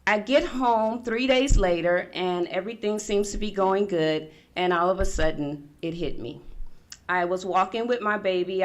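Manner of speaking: usual speaking voice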